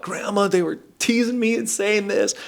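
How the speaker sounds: put-on high, childlike plea to grandma; high little-kid voice complaining; whiny child. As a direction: play your younger self pouring out hurt. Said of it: "I'm pouring my soul out".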